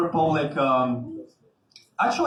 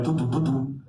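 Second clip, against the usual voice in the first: low mock soap-opera music; hummed dramatic sting; 4 semitones lower than usual. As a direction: hum the suspense cue. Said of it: "the music goes like".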